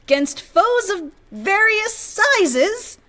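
slow, high sing-song recital; poem recital. Acted. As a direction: recite it slowly like verse. Reciting improvised poem aloud.

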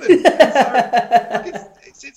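high-pitched burst of laughter breaking into the words; laughing hard. Real, not acted. Telling a friend's race story, laughing helplessly. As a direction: crack up and squeak out the words.

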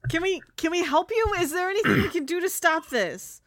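high, rushed, panicking; panicked. Acted. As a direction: beg to help in a panic. Panicked offer to help.

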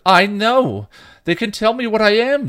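loud, very high cry; upset, insistent, pitch soaring; distraught retort. A narrator's reading. Dawn squirms with tears running, distraught.

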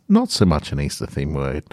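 ordinary delivery, nothing marked

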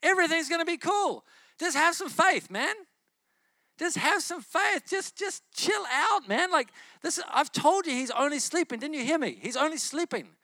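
high, loud, breezy reassurance; casual laid-back Jesus. Retold or imitated. Preacher imagining himself as Jesus calming mourners, high and breezy. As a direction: play a chill bro telling everyone to relax. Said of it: "I'd be like".

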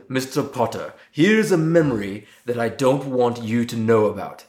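slow, drawn-out professor's impression; Alan Rickman as Snape. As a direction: stretch each phrase like a menacing schoolmaster. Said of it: "really good Alan Rickman impression".

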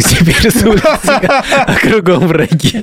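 high, slow meme chant; TikTok meme voice. Retold or imitated. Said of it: "типа".

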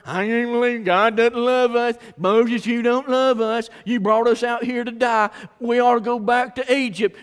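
loud, high mock whining; hammed-up aggrieved grumbling; grumbling complaint. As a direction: bellyache like a disgruntled crowd. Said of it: "just grumbling and complaining".

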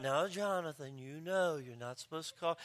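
lightly voiced soft-spoken dad; mild father. Imitated father feebly correcting his son, lightly voiced, soft. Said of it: "the father would come out".

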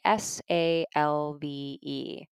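slow, letter by letter: S A L V E; spelling it out. Shown as a demonstration. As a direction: spell SALVE slowly for the class. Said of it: "is called salve".

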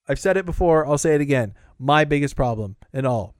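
ordinary delivery

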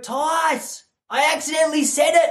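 high-pitched mock distress; panicked confession. Follower confesses a slip to Tice, mock-panicked. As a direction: blurt it like a guilty fan.

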